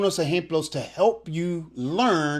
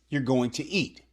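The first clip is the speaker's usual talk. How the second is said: ordinary talk, nothing marked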